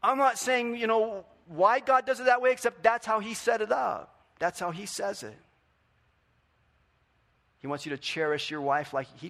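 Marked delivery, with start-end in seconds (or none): 0.0-5.4 higher, louder passionate preaching; full-force pulpit passion; fired-up preaching. Acted. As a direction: preach it hard, fast and urgent.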